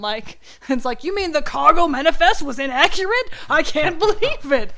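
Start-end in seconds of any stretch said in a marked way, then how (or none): 1.0-4.7 higher, louder pretend disbelief with a laugh; hammed-up shock, breaking into laughter; mock astonishment. Cohost mocking how thrilling shipping paperwork is to kids, mock astonishment. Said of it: "hard for a kid to get their head around".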